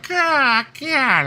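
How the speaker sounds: very high squeaky God; mock squeal. Friend mimics someone's squeaky cry of God.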